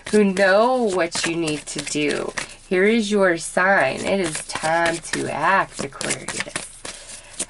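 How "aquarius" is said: slow, breathy whisper of the name; dramatic whisper. Real, not acted. Dramatic sign, time to act.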